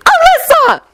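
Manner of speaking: very high scandalised exclamation; high-pitched shocked reproach; horrified cry. A narrator's reading. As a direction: cry out in horror. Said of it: "Molly cried horrified".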